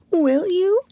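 high little whimper; plaintive, high-pitched question; whimpering child. A narrator's reading. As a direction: ask it like a tearful small girl. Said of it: "whimpered Lottie".

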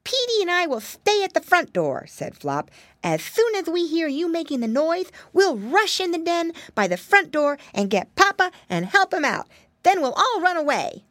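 a small leader giving orders; little pig Flop, commanding. Acted. Flop finishes plan.

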